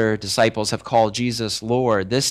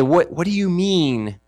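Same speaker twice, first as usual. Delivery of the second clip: slow, higher-pitched questioning; stretched-out disbelief; bewildered; 6 semitones higher than usual. Acted. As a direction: ask it as if stunned. Acted disciples questioning Jesus.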